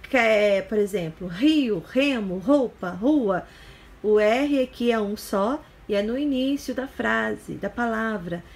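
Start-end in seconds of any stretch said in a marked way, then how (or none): 1.3-3.5 "rio, remo, roupa, rua" each with a strong h-like initial R; R forte inicial. Shown as a demonstration. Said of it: "R no início da palavra também sempre é forte". ("rio, remo, roupa, rua" com erre inicial forte e aspirado)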